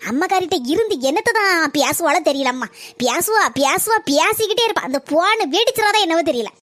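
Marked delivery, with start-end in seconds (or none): none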